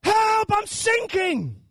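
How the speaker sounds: loud, very high cry for help; hammed-up drowning scream; desperate cry. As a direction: yell it like you're going under. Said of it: "a prayer we probably have all cried".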